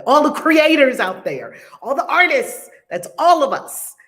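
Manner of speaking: loud, high, punchy call to everyone; rallying shout-out. Real, not acted. Host sending love to all the artists, rallying shout-out. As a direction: call out proudly to the whole crowd.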